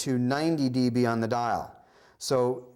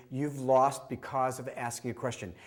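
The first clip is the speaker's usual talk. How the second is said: ordinary talk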